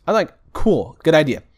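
talking through hiccups; hiccuping between words. Real, not acted. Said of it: "I got the hiccups".

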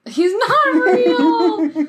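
high, drawn-out squeal through laughter; cracking up as she cries it out; laughing squeal. Real, not acted. Laughing outburst at the joke.

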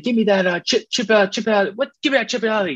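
high, yelled mock coach rant; imitating an angry coach mangling a name; coach going off. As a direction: rant like a fed-up coach at practice. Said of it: "Bill O'Brien went off and was like".

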